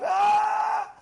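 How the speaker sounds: loud, high sustained "aah" scream; acted high-pitched holler; husband's scream. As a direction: imitate a man yelling at his wife. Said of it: "Imagine a guy screaming at his wife".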